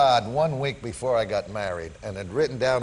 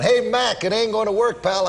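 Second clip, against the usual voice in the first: high, loud retold heckle; taunting holler; 11 semitones higher, 4 dB louder than usual. Player hollering at rival manager all game, loud taunting holler. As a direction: holler it across at your rival with glee. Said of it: "I kept hollering".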